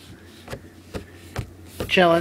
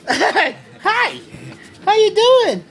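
much higher, louder pet talk; cooing at a kitten; 12 semitones higher, 4 dB louder, slower than usual. Real, not acted. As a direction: call to the cat in a high sing-song. Greeting the kitten.

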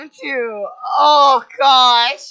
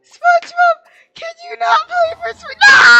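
hammed-up wailing grief; 6 dB louder than usual. Real, not acted. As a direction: overact the grieving friend, wailing. Still wailing over SpongeBob.

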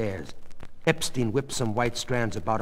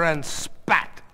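ordinary delivery, nothing marked

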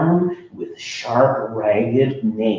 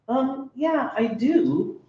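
put-on sheepish kid voice; 13 semitones higher, 4 dB quieter, slower than usual. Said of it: "I admitted".

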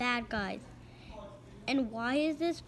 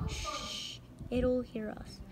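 unvoiced hush; shushing; 5 dB quieter, slower than usual. Crook hushing the gang so the alien won't hear. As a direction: hush them urgently.

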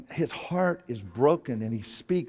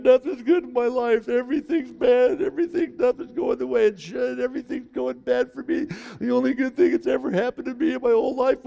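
high-pitched, louder self-pitying whine; whiny Jonah; 12 semitones higher, 5 dB louder than usual. Retold or imitated. Preacher mocks Jonah complaining to God, high whiny voice. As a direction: whine it like a sulking child to God. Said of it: "he whines about it".

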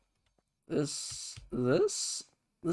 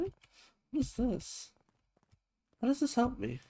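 high confused squeak; 11 semitones higher, 6 dB quieter than usual. Real, not acted. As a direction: squeak in baffled disbelief. Confused at game menu.

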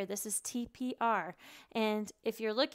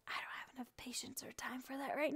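whispered aside; 2 semitones higher, 10 dB quieter than usual. Real, not acted. Aside about DIY props.

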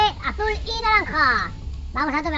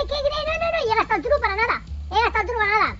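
frustrated; 5 semitones higher, 4 dB louder, faster than usual. Real, not acted. Wasted turbo.

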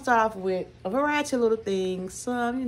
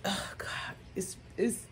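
breathless whisper of delight; savoring a smell; 10 dB quieter than usual. Real, not acted. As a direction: whisper it like you just smelled heaven. Smelling new candle.

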